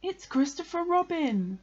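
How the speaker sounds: high, excited announcement; eager. A narrator's reading. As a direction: burst out with happy recognition. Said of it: "said Pooh eagerly".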